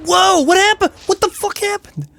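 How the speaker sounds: high-pitched, stunned disbelief; startled outcry. Real, not acted. Gamer caught off guard by the boss, startled. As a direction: blurt it out in high-pitched shock. Startled by a sudden game event.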